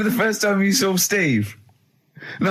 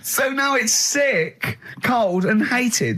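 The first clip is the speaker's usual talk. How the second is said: slow mock pity; 6 semitones higher, much slower than usual. Sums up the poor cat.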